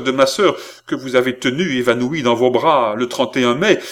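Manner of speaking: loud, heated accusation in bursts; jerky, impassioned cry. Acted. Brother demands honour for his sister, crying out jerkily. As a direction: demand satisfaction in jolting phrases. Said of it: "s'écria Philippe d'une voix saccadée".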